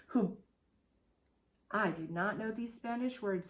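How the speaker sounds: ordinary delivery, nothing marked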